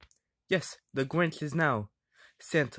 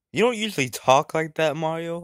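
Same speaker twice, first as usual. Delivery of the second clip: ordinary talk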